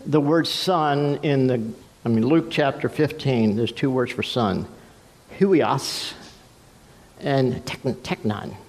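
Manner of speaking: ordinary delivery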